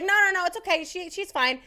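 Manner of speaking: high, hurried imitated reassurance; dismissive nurse. Covering nurse saying patient is fine, dismissive.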